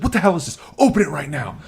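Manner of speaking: acted angry order, pitched high; high mock scolding; imitated yelling mom. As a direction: demand it like a furious parent, retold. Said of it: "yells at him".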